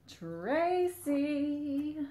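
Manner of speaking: high, drawn-out singsong call; playful sustained holler to someone not there. Pretend. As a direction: call the name out long and high. Calling out to a viewer.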